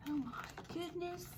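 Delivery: soft, high gasp of concern; awed little aside; hushed aside. Real, not acted. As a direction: breathe it quietly to the listeners.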